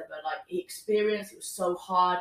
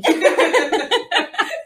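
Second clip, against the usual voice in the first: loud high laugh; high burst of laughter; 10 semitones higher, 10 dB louder than usual. Real, not acted. Laughing at own story.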